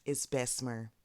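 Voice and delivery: standard, understated "Bessemer"; proper pronunciation. Shown as a demonstration. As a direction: say "Bessemer" neatly, with every syllable. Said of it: "do I say it like that".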